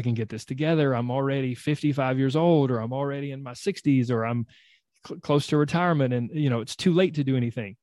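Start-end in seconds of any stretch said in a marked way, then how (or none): none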